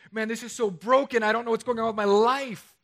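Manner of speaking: loud, high-pitched mock despair; acted distraught cry. Preacher voices someone coming to you in crisis, acted distraught. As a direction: wail it out, imitating someone in distress. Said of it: "they're crying and they're saying".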